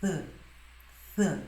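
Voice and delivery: tongue between teeth, th breathed out; th demo; interdental th. Shown as a demonstration. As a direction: let air out over the tongue. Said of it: "a representação fonética do".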